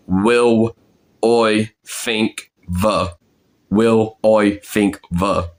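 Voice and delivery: slow "Will I think the" as "wiw oy fink va"; Cockney pronunciation. Shown as a demonstration. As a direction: practise "Will I think the" slowly, word by word. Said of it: "problem that I'm ... struggling with".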